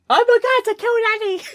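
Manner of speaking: very high mock shriek; shrieked Kenny line. Reacting to the episode, a high cartoon shriek. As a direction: squeal it like a cartoon kid in shock. South Park quote, shrieked.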